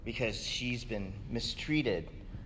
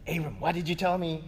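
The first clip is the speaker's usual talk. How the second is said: higher, quick, indignant king's voice; Pharaoh confronting Abram; 6 semitones higher, 4 dB louder than usual. Retold or imitated. Pharaoh scolding Abram for lying, indignant and higher. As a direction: play the wronged Pharaoh scolding. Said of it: "he's like".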